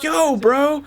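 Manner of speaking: high-pitched hurt disbelief; betrayed "yo bro". Retold or imitated. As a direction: squeak it out like a let-down friend. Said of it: "And I was like".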